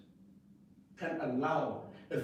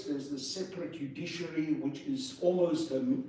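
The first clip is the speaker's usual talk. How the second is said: ordinary talk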